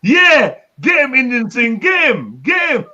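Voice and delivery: high, loud egging-on; mock hype shout. Streamer jokingly sets friend on the teaser, high hyped shouting. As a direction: shout them on, pitch leaping on each burst.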